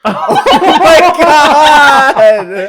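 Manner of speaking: high, loud, drawn-out shocked laugh; shocked laughing shriek. Real, not acted. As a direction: shriek it in disbelief. Shocked at grandmother found dead.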